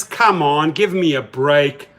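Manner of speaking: normal talking voice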